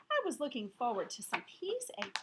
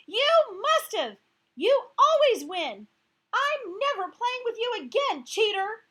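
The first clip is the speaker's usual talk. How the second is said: raging high shout, much louder; 10 semitones higher, 15 dB louder than usual. Acted. Gail shouting at Heidi and calling her cheater. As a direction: bellow every word at her. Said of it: "Gail shouted".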